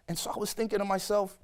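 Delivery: ordinary delivery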